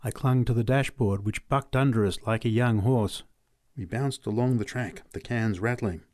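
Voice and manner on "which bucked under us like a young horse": plain reading voice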